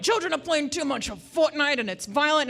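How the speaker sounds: high, loud, mocking panicky parent; mocking headline voice. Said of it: "So many, like".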